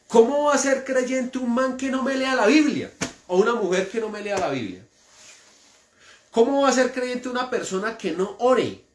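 high, rising indignant preacher; preacher's indignant rhetorical questions; fiery preaching. Acted. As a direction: hammer each question at the church. Preaching at full force.

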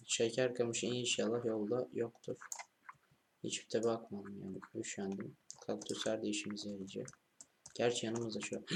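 ordinary talk, nothing marked

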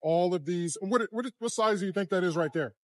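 usual speaking voice

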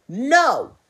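one big, punched no; emphatic refusal. A narrator's reading. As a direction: refuse it loudly and firmly. Kangaroo refuses the shark.

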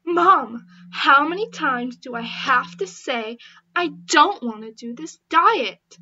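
loud, fed-up yelling at mom; teen screaming at mom about her diet; angry yell. Acted. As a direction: shout at your mother in frustration. Arguing with mom.

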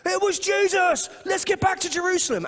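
high, loud, thrilled exclamation; hammed-up elated realization; excited disciples. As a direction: burst out with the discovery. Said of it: "They just go".

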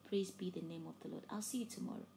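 normal talking voice

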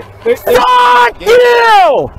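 high angry yelling with curses; furious shouting. Real, not acted. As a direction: scream the insults at him. Shouting insults at the officer.

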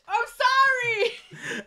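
high, slow, hammed-up crying; mock sobbing apology. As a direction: whimper it like a scolded kid. Tourist scolded, mock apologizing.

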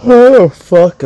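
words stretched by a yawn. Real, not acted. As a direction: talk through a yawn. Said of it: "am I still yawning".

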